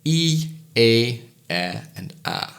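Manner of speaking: E, A, E, A stepping the tongue down; vowels spoken one by one, tongue lowering; vowel sequence demo. Shown as a demonstration. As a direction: glide down through E and A. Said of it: "Once more".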